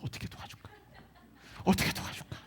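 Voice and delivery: soft unvoiced whisper of a watching parent. Parent quietly wondering how to help the child, whispered.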